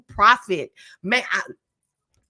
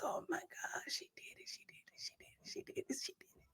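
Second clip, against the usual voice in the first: tearful whisper; 11 semitones higher, 22 dB quieter than usual. Retold or imitated. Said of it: "I was like".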